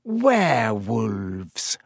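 low, drawn-out menace; slow menacing teacher. A narrator's reading. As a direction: let the word hang darkly. Snape announces topic.

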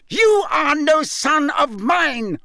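high, slow, loud furious disowning; a father roaring down at his son; Crouch bellowing. A narrator's reading. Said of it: "bellowed Mister Crouch".

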